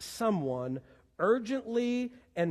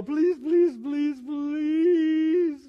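loud, high, drawn-out pleading; passionate begging; 11 semitones higher, 8 dB louder, much slower than usual. Acted. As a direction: beg at full volume, stretching the last please. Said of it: "beg someone with urgency and with passion".

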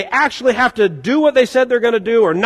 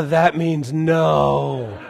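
put-on knowing toddler; 5 semitones lower, 4 dB quieter, much slower than usual. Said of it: "three-year-old son go".

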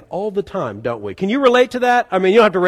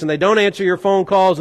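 ordinary talk, nothing marked

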